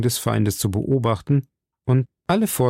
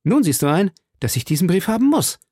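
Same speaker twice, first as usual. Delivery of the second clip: raised, quicker insistence; called out; 7 semitones higher, faster than usual. A narrator's reading. D'Artagnan to the bandit, raised and fast. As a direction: press the point hard. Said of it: "rief D'Artagnan".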